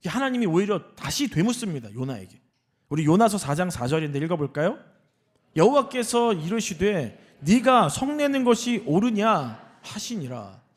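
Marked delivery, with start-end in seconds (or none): 5.6-10.6 slow, higher emphatic Bible reading; measured, proclaimed pulpit reading; scripture reading. Acted. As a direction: read the verse aloud deliberately, projecting.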